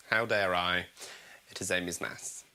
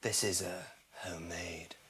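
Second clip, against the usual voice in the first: hushed whisper on homemade; whispered reveal; 12 semitones lower, 11 dB quieter than usual. Pretend. Presenter revealing her homemade Scotch egg in a whisper. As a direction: whisper it like a secret.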